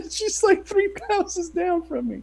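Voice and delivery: high, laughing through the words; squeaky-high amused disbelief. Real, not acted. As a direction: barely get it out for laughing. Laughs while telling own story.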